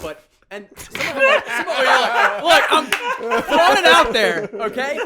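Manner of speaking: squealing high and loud through laughter; cracking up as he admits it; laughing. Real, not acted. Confessing to podcast friends while laughing hard and loud. Laughing admission about smelly players.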